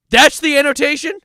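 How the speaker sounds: high, loud outraged question; loud incredulity. Pretend. Comic finds a useless lyric annotation, loud incredulity. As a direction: exclaim it in comic disbelief.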